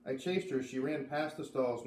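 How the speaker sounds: usual speaking voice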